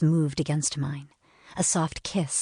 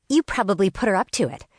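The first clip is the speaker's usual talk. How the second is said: fast, higher and louder, biting each word; hissed accusation; 5 semitones higher, 4 dB louder, faster than usual. A narrator's reading. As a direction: spit the blame at her quickly. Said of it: "she hissed".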